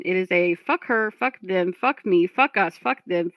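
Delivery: usual speaking voice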